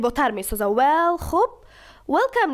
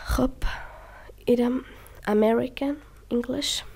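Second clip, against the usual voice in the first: whispered aside; 4 dB quieter than usual. Real, not acted. Teacher's aside after clip.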